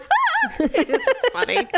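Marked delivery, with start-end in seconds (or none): 0.0-0.6 high shrill startled cry imitating him; startled yelp. Imitating Daryl woken by the flashlight, very high yelp. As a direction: yelp as if jolted from sleep. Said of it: "he's like".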